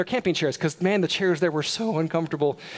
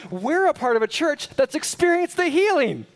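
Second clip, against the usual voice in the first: higher, louder preaching at full force; 9 semitones higher, 3 dB louder than usual. Acted. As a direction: drive it home to the congregation.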